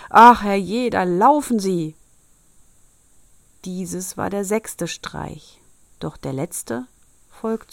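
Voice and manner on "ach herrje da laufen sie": der Bäcker schreit laut „Ach, herrje“; brüll den fliehenden Buben hinterher; Schrei. Acted. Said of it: "Und der Meister Bäcker schrie". (lauter, entsetzter Ruf)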